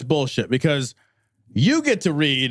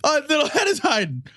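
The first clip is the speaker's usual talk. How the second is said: put-on higher, sing-song baby talk rising to a squeak; mock cooing that jumps much higher at the end; 9 semitones higher than usual. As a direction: coo it like talking to a hiding pet. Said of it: "It's like".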